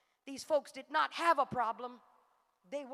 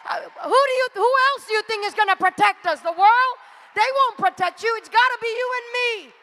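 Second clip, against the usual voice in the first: louder, higher preaching that keeps building; full-force preaching; 5 semitones higher, 10 dB louder than usual. Acted. Preacher rallying the congregation to protect their church, full force, building.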